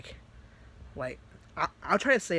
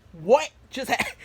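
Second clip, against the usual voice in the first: loud, high retold panic; terrified; 8 semitones higher, 8 dB louder than usual. Natsuki coming out of paralysis, terrified. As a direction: blurt it out in mock terror. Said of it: "she was terrified".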